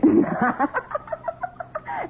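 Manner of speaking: very high, soft mocking laugh; scornful laugh. Wife mocking her husband's offer, scornful laugh. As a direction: laugh in his face.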